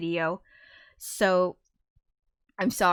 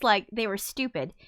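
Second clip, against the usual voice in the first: ordinary delivery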